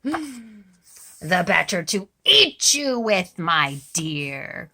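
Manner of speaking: loud, slow menacing cry; cried out. A narrator's reading. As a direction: roar it as he pounces. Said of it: "cried the wolf".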